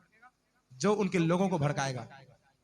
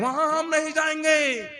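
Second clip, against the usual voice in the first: high, loud and slow, as defiant spies; loud refusal; 8 semitones higher, 7 dB louder, slower than usual. Acted. Spies scaring the people off Canaan, loud refusal. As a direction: cry out a drawn-out, high refusal.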